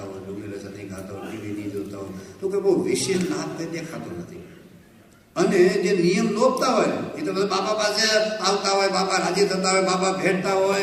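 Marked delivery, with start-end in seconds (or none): 5.4-10.8 raised, higher, louder preaching at full force; fervent preaching. Acted. Preacher to the assembled devotees, fervent loud preaching. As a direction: preach it out to the whole hall.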